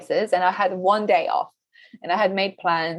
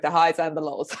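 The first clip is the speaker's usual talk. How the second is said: laughing aside. Real, not acted. Guest jokes about entrepreneur highs.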